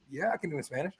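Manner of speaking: said softly, offhand; tossed off under the breath, retold. Retelling his casual answer.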